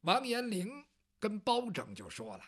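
usual speaking voice

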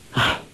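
regretful sigh. A narrator's reading. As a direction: sigh mid-thought, resigned. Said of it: "黄蓉叹道".

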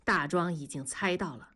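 usual speaking voice

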